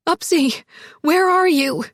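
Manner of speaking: anxious call falling at the end; frantic calling out. Acted. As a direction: call out for someone missing. Frantic search outside for missing Emma.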